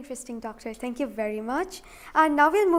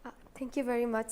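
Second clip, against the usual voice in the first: whispered thanks; 10 dB quieter, faster than usual. Real, not acted. Host thanks guest quietly.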